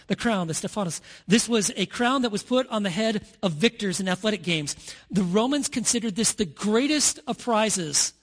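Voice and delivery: usual speaking voice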